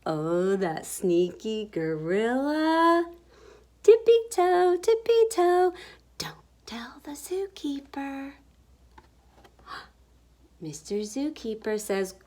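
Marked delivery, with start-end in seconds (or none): 3.8-5.7 high, bouncy sing-song creeping; playful high-pitched tiptoe chant; sneaky tiptoe. A narrator's reading. Creeping animals in story. 6.2-8.3 hushed conspiratorial whisper; soft, sneaky whisper to listeners; secretive whisper. A narrator's reading. Secret kept from zookeeper.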